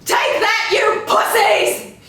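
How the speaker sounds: high, loud, slow taunt; gloating while firing; triumphant shooting yell. Acted. Wendy firing out the window.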